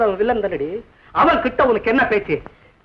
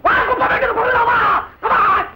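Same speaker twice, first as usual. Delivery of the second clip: angry dare; 9 semitones higher than usual. Acted. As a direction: bellow the dare at him. Daring rival to fight.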